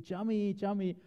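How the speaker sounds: high squawky parrot call of a name; parrot screaming. Retold or imitated. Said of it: "he used to just scream".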